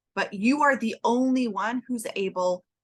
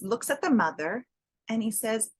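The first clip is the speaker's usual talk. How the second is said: normal talking voice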